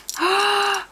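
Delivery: high sharp gasp of delight. Real, not acted. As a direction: gasp at the colourful buttons. Gasp at new buttons.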